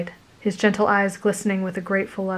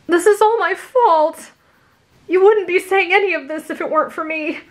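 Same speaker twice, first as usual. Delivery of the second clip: guilty panic; 10 semitones higher, 4 dB louder than usual. Acted. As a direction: blurt the guilt in anguish. Izuku confesses in guilty panic.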